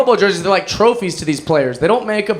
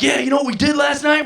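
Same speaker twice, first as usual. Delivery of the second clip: bragging; 7 semitones higher than usual. Retold or imitated. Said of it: "We're like".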